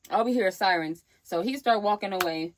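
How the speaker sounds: ordinary delivery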